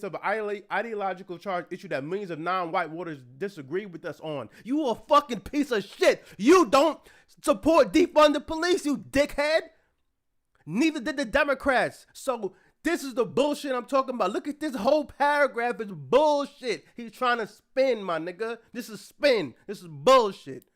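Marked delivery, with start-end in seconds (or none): 4.6-12.1 furious, much louder, higher rant; real outburst, shouting insults; real angry yelling. Real, not acted. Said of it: "I'm getting upset". 12.1-20.6 real fury, spitting out bullshit; angry ranting. Real, not acted. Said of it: "I'm getting upset".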